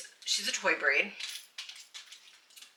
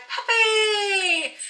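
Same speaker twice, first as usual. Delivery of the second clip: excited squeal; 5 dB louder than usual. Retold or imitated. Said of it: "I'm like".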